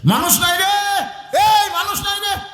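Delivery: very high, hollering village madman; madman's cry. Acted. Madman passing a crowded feast, high hollering cry. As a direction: holler it high, as if to all around. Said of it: "পাগলা side দিয়ে যাচ্ছে আর বলতেছে".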